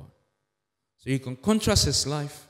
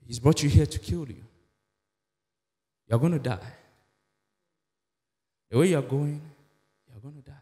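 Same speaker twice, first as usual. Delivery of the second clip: tempter's voice; 3 semitones lower than usual. Acted. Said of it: "that's what the tempter is saying".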